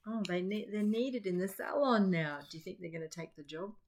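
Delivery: ordinary delivery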